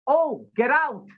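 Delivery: high, drawn-out mock exclamation; playful high-pitched imitation, slowly stretched; imitating delighted recognition. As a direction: quote someone suddenly remembering a famous line. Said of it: "the first thing he said was".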